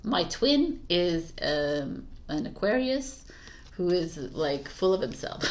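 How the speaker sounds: normal talking voice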